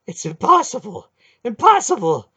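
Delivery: high, loud, shocked cry of disbelief; shocked protest. Acted. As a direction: shout it out in utter disbelief. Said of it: "the hierarch protested".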